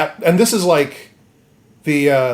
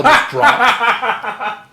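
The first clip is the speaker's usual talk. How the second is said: higher, breaking into laughter; laughing; 10 semitones higher, 3 dB louder than usual. Real, not acted. Teller amused by the limp fall, laughing. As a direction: crack up while saying it. Teller cracks up at own gag.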